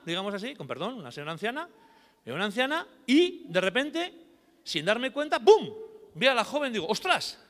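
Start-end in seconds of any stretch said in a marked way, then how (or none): 2.2-6.7 rising to a high, loud burst on bum; sudden excitement. Retold or imitated. Retelling a sudden realisation. 6.9-7.4 surprised gasp. Retold or imitated. Retold own amazement.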